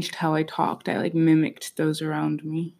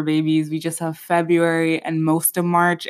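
normal talking voice

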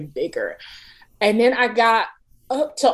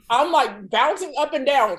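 ordinary talk, nothing marked

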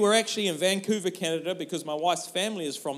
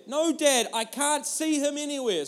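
put-on high, louder child voice protesting; a kid insisting it's gone; upset little girl; 9 semitones higher, 3 dB louder than usual. Daughter contradicting Dad from the back seat, high upset voice.

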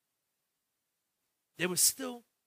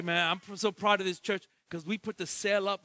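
normal talking voice

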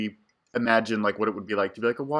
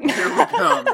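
put-on mean girl strut; 14 semitones higher, 5 dB louder, slower than usual. Acting the mean girls.

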